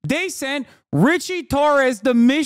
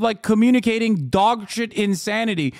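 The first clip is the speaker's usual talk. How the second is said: ordinary talk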